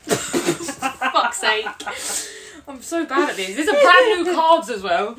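real helpless laughter through the words; laughing exasperation. Real, not acted. Fumbling brand new slippy cards, exasperated through laughter.

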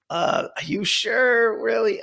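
high, drawn-out skeptical mom; doubtful mom. Retold or imitated. Mother doubts his request.

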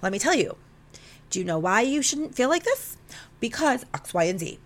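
lightly acted take-charge explainer; masculine fix-it energy. Trying to fix his feelings with logic, mock masculine fix-it energy. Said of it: "picked up my masculine energy and was like".

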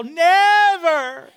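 put-on toddler yell. Son flopping on the floor, mock yell. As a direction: yell it like a three-year-old. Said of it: "just yell".

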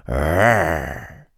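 low mock roar from Monty; a big brother's playful growl. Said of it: "Quando o Monty rugia". (rugido grave de brincadeira)

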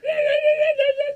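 high, loud eager yeah chorus. Retold or imitated. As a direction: pile on eager agreement. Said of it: "And everyone's like".

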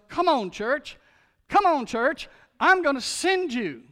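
loud, high, urgent summons; rousing call. Retold or imitated. God voiced calling the church.

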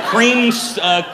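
normal talking voice

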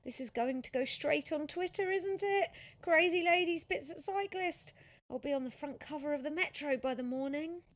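high, loud, rising panic; groaning dismay. A narrator's reading. Sophia dreading the photos going online, groaning in dismay. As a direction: wail about the coming embarrassment. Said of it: "Sophia groaned".

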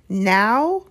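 frantic disbelief. A narrator's reading. Harry learns of the bad timing, frantic disbelief. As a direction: blurt it as a dismayed question. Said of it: "said Harry frantically".

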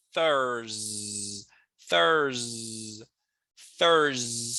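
"Thurs" held long and low, starting on TH; low, lengthened demo of "Thurs" with TH; TH sound. Shown as a demonstration. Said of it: "É o som do T H".

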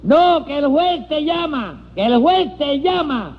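high, exasperated holler; high insistent call; shouting to the deaf. Acted. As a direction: correct a deaf man at the top of your voice. Repeating for a deaf man.

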